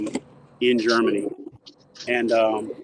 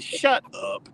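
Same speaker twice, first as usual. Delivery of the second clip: mock disbelief; 13 semitones higher than usual. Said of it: "You just hung up on Ray Scott".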